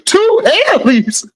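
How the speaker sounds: drawn-out, high incredulous imitation; mock disbelief. Quoting the chat's reaction to two Haleys, mock disbelief. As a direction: pitch it way up as a mock refusal. Acting out chatter's refusal, amused.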